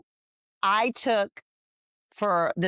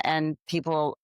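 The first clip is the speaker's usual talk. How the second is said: ordinary talk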